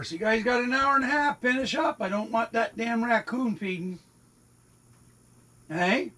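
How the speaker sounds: pitched well up, chiding the cats; high mock-stern pet voice; pet talk. Real, not acted. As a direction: urge the cats to finish, voice lifted. Hurrying the cats to eat.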